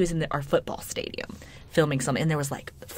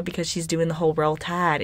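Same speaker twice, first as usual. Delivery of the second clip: ordinary delivery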